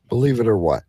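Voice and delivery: ordinary delivery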